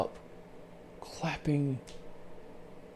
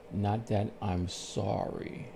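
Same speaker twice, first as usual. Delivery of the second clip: ordinary talk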